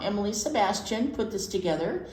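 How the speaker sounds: ordinary delivery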